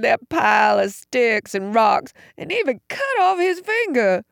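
put-on high-pitched, childlike tearful voice; upset, high, countrified lament. A narrator's reading. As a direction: plead through tears like an overgrown child. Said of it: "Tears ran down his face".

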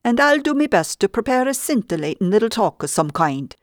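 mock Irish brogue, half in jest; playful Irish brogue. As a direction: play at being Irish to amuse her. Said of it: "I said in my best Irish brogue".

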